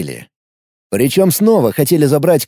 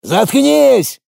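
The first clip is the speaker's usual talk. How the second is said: bark; 10 semitones higher than usual. A narrator's reading. Thug barks at the mother to shut up. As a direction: snap the order at her. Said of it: "рявкнул бандит".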